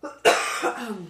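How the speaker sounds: a sudden loud cough; one harsh chesty cough; coughing fit. Real, not acted. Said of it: "es hüstelt noch aus".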